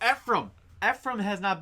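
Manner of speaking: loud sudden exclamation; excited. Real, not acted. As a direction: blurt the name out. Suddenly remembers another unit.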